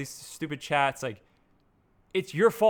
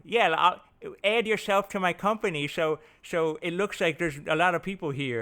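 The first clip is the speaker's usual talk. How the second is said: a hint of a smug founder inviting you in; mock startup hype; 3 semitones higher than usual. Mocking fake startup team boasts.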